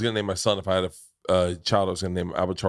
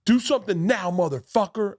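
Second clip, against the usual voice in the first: angry yell; 10 semitones higher than usual. Retold or imitated. Road rager at car window.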